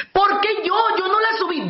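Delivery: a husband protesting to his wife in mock outrage; indignant husband. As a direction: act out an offended husband who didn't leave the lid up. Counselor voices indignant husband.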